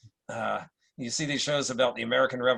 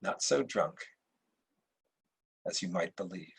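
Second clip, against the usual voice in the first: put-on frail, low old emperor; a hint of a weak dying ruler; 6 semitones lower, 9 dB quieter than usual. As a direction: speak as a dying father to his son. Dying emperor speaks to son.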